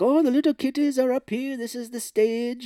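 high, lilting put-on voice; mock sweet usher. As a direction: usher him on with false cheer. Acting out disguised Irish character.

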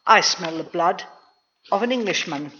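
usual speaking voice

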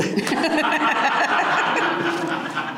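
squealing burst of laughter; very high laugh. Real, not acted.

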